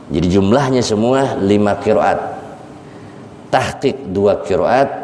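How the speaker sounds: ordinary talk, nothing marked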